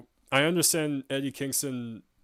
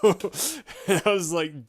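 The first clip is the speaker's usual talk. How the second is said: laughing; 7 semitones higher, 7 dB louder, much slower than usual. Real, not acted.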